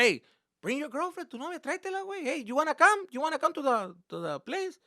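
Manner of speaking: high, eager dad's invitation; imitated dad. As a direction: invite her along as an insistent dad. Dad voiced inviting the girlfriend.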